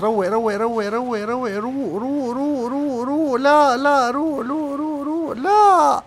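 high, loud rapid-fire chant climbing to a wail; frantic panic. Real, not acted. Gamer frantic over a last attack, high panicked chanting.

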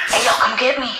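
normal talking voice

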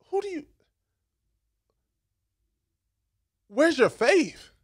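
exasperated scolding. Retold or imitated. Jesus scolding the disciples.

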